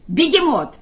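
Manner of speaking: high-pitched call to the cat; mild narrated shout. A narrator's reading. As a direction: summon the cat sharply. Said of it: "закричал он коту".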